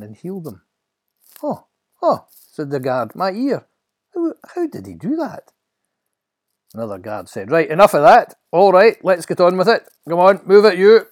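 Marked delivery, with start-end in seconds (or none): 1.4-2.3 very high, loud little ohs; astonished gasps. Acted. Guard just healed by a touch, astonished. 3.1-5.5 high-pitched amazed stammer; astonished. Acted. Guard feeling his healed ear, astonished. 7.5-11.1 very loud, raised-pitch orders; bully the prisoner along loudly; bossy guard. Acted. Guard hurrying the prisoner away, bossy shouting.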